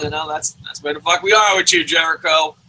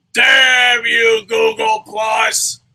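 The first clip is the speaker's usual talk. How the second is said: loud, higher, slow drawn-out curse; mock Big Show curse; 5 semitones higher, 5 dB louder, much slower than usual. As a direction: stretch Google Plus out in fake agony. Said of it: "he's like".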